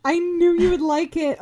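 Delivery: high, loud, thrilled burst; delighted excitement. Real, not acted. Host thrilled her friend liked the album, delighted.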